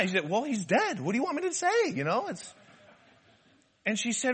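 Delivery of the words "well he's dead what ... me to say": exasperated retort climbing high in pitch; mock defensive husband. Husband answering his upset wife, mock defensive. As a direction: imitate a husband protesting his innocence.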